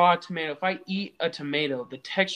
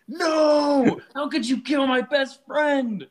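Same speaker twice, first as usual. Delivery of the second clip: high, loud cry of betrayal; a loud, high mock wail of grief; child's anguished wail; 8 semitones higher, 5 dB louder, slower than usual. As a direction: wail it like a heartbroken kid. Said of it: "I started crying".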